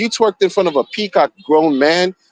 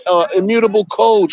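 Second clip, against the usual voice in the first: normal talking voice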